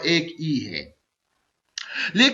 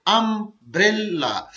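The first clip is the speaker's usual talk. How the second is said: Umbrella slowly, अम-ब्रे-ला; syllable by syllable; slower than usual. Shown as a demonstration. Teacher demonstrating a three-syllable word, umbrella slowly split in three. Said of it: "ये भी three syllable word है".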